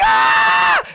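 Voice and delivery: very high loud wail of pain. Retold or imitated. Boy answering his mother, high loud wail of pain. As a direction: howl as if it still stings. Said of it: "I'm like".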